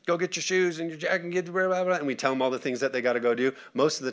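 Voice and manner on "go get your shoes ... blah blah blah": higher-pitched parent calling out orders; raised calling voice, running into blahs; mock yell across house. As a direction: imitate a parent hollering to the kids, half-hearted. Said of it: "we yell across the house".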